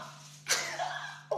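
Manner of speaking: breathy burst of laughter; laughing. Real, not acted. After shrieking, he bursts out in airy laughter. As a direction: break into a laugh.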